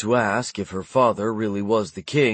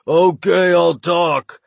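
panicked, high and louder; frightened scream; 8 semitones higher, 6 dB louder, much slower than usual. A narrator's reading. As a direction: blurt it out in terror. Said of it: "he screamed".

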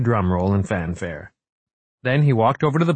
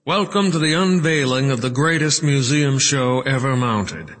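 grand, high-pitched ceremonial welcome; amplified podium speech; 6 semitones higher than usual. A narrator's reading. Wright welcomes the crowd, grand amplified oratory. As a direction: address a big hall through the microphone. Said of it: "Wright's amplified words echoed through the hall".

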